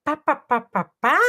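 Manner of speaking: high delighted gasp then pá pá back as a question; excited echo of babble. Retold or imitated. Parent mirroring a baby's babble, high and delighted. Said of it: "e você".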